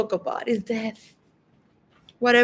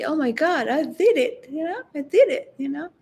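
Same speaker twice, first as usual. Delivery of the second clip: relieved triumph; 5 semitones higher than usual. Pretend. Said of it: "and say".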